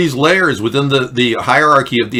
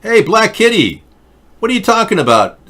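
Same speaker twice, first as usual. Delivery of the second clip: raised indignant voice; 6 semitones higher, 3 dB louder than usual. Real, not acted. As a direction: push back hard, high and loud. Confronting chatter, raised voice.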